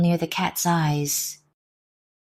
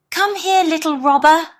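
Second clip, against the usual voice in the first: much higher, louder call; 12 semitones higher, 7 dB louder than usual. Acted. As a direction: call the little one over. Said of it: "he called to the smallest robber".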